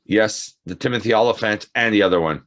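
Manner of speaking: normal talking voice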